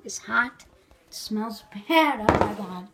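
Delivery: ordinary talk, nothing marked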